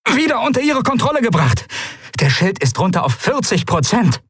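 very high, strained report; urgent battle report. Acted. Captain reporting failing shields to admiral, urgent high-pitched call. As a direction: press on over the thunder of impacts.

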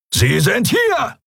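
high, drawn-out pirate bellow; roared shout. A narrator's reading. Pirate spots the fugitives, roaring to his crew. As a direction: roar the alarm to the others. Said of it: "brüllte einer von ihnen".

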